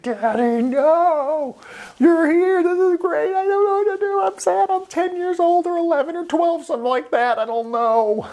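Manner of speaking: put-on very high squeaky little-girl voice, pitched far up. A daughter squealing at her dad, hammed-up. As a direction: squeal it like a frantic kid.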